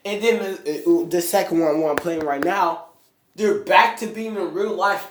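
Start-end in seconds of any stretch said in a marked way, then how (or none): none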